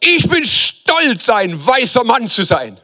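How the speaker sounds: mock proud bigot. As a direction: ham up a smug braggart in the pub. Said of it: "und sagt". (übertrieben prahlerischer Stammtischredner)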